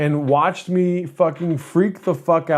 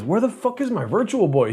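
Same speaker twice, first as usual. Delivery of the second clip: searching cousin; 6 semitones higher, 3 dB quieter than usual. Retold or imitated. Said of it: "And then he goes".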